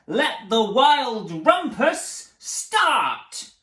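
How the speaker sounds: high, loud and slow; grand proclamation. Acted. Max proclaims the rumpus, loud, high and slow. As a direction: stretch each word out like a king. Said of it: "cried Max".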